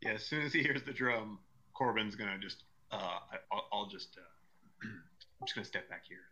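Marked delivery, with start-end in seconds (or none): 3.3-6.2 hushed breathy whisper. Acted. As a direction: whisper it, shrinking back nervously. Terrified character backs away.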